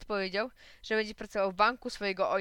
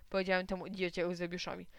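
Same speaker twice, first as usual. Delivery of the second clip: ordinary delivery, nothing marked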